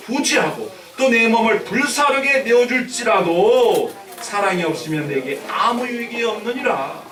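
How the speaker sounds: loud, emphatic verse declamation; scripture reading. Acted. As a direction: proclaim the verse to the congregation. Reads the scripture aloud.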